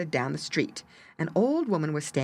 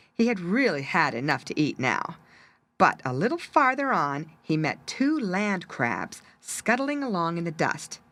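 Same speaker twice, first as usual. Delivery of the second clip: plain reading voice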